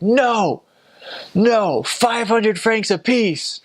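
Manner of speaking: high, slow, haggling taxi driver voice; mock taxi driver. Driver to rich-looking foreigner, high slow haggling. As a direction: stretch out the overpriced offer like a cheeky driver.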